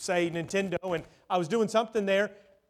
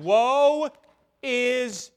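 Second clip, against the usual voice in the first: higher, louder drawn-out wail; mock wailing cry; 6 semitones higher, 8 dB louder than usual. Preacher acting out a cry of woe, mock wailing. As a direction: cry it out high and big.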